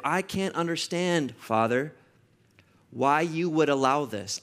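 heartfelt prayer addressed to Father; earnest prayer. Acted. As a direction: pray it from the heart. Pastor prays aloud.

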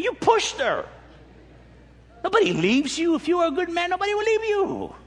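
high-pitched, heated accusation; getting angry. Acted. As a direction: scold the husband, pitch climbing. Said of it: "I'm getting angry".

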